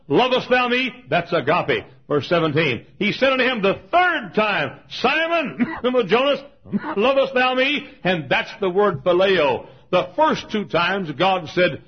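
high, loud preaching cadence; loud revival-preacher delivery; full-force preaching. Acted. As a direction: preach it out to the congregation.

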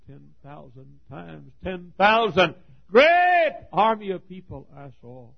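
loud, high preaching, stretched on the first words; preaching at full force. Acted. Preacher to his congregation, retelling his mother's question, preaching loudly. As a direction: thunder it out to the pews.

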